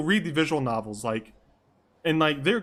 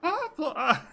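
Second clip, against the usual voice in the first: very high falsetto greeting; high-pitched mock character. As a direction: greet in a silly high put-on voice. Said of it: "do every voice like this".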